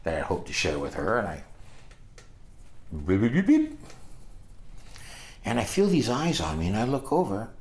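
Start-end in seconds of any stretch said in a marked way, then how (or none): 3.1-3.8 high rising run of beeps; imitated beeping. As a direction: make quick little high-pitched beeps. Imitates a little noise mid-story.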